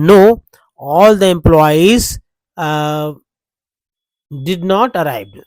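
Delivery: loud "No", then slow "all the employees did not arrived"; alternative negative answer. Shown as a demonstration. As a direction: give the second way of saying no slowly. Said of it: "या इस तरह से बोल सकते हैं". (दूसरा नकारात्मक रूप, धीरे-धीरे)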